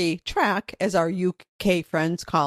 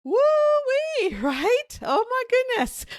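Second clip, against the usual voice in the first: much higher, squealed "woo-wee"; woo-wee exclamation. Real, not acted. Host shaking off the acted spiral, squealing much higher. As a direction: let out a giddy high squeal of relief.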